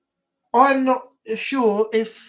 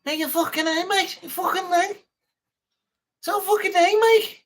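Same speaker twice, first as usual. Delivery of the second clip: high-pitched, exaggerated Scouse accent; mock Scouse accent; 8 semitones higher than usual. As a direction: put on a squeaky-high Scouse impression. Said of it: "At least it's not a Scouse one".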